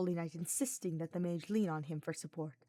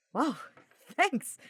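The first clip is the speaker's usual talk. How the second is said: laughing; 8 semitones higher, 8 dB louder than usual. A narrator's reading. Sylvain laughs thanking Hubert.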